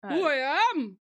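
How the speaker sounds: slow, hammed-up laughing brag; mock diva. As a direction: play the offended celebrity.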